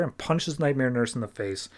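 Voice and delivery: normal talking voice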